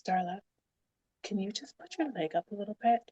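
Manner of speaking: gentle. Retold or imitated. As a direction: ask it quietly, imitating her tenderness. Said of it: "I remember being so gentle around me".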